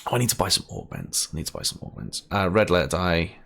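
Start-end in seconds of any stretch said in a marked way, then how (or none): 1.3-2.2 softer, whispered repeat under the breath; whispered to himself. Real, not acted. Muttering to himself about buying augments, whispered. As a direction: murmur it again just for yourself.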